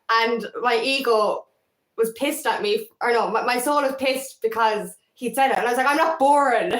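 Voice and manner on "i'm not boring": raised and higher, defensively insisting; mock-offended, pitched up, triggered comeback; indignant retort. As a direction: snap it back like you've been insulted. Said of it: "That's the way I said it".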